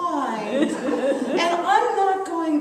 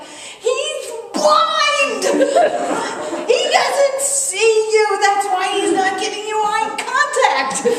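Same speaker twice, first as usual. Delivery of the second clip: higher, louder outburst breaking into laughter; a laughing yell defending her husband; retold scream; 7 semitones higher, 6 dB louder than usual. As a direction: blurt it out, half screaming and half laughing. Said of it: "and I scream out".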